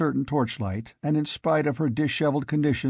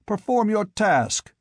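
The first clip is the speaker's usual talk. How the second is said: higher and sharper, demanding; angry exclamation; 8 semitones higher than usual. A narrator's reading. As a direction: bark at the slow headsman. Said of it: "he exclaimed angrily".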